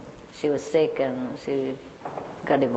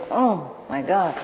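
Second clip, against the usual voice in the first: slow, drawn-out "Oh my God" in wonder; awed gasp; slower than usual. Retold or imitated. Walking into a spaceship-like house, slow awe. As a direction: breathe it out amazed.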